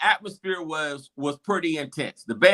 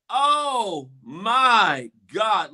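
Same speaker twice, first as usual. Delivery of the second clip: drawn-out mock groan starting high; crowd disbelief; 3 semitones higher, much slower than usual.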